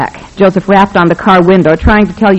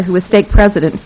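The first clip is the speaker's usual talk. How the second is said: usual speaking voice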